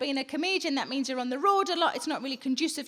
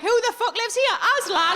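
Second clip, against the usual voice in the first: higher, louder shocked cat; mock scandalised kitten; outraged cat; 8 semitones higher, 6 dB louder than usual. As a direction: bellow it like a startled cat. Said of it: "the cat would be like".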